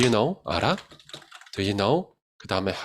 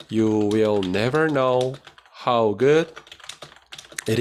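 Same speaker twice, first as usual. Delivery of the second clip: slow dictation; much slower than usual. Shown as a demonstration. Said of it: "넌 절대 모를 거야".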